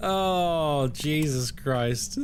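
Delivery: slow Jesus Christ through laughter; laughing. Real, not acted. Streamer cracks up at a failed umlaut, laughing. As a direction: drag the words out while laughing.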